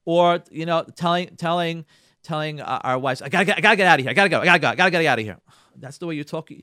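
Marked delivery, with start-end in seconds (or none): 3.2-5.4 loud, hurried repeated excuse; mock frantic rush. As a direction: blurt it out while rushing off. Acted husband rushing out.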